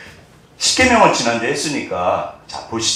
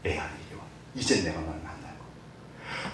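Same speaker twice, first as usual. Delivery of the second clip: hushed, unvoiced, much softer call to come; tender whisper of God; 10 semitones lower, 19 dB quieter than usual. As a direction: whisper it like a loving father calling a child. God's tender invitation voiced.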